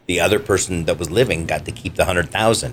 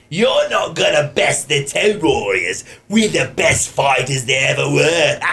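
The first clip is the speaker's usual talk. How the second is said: Australian punk voice; 6 semitones higher than usual. Shown as a demonstration. Rings boasting about the Toad Warriors in an Australian punk voice. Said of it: "he sounds like this".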